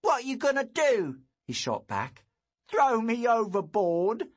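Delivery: high loud sassy taunt; a bully daring him. A narrator's reading.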